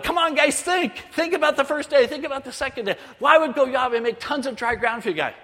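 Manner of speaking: high, exasperated urging; frustrated begging. Retold or imitated. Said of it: "And I'm begging God".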